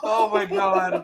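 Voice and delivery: slow, high, helpless laughing exclamation. Real, not acted. Listener reacting to the dog story, laughing. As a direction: laugh right through it, real and loud.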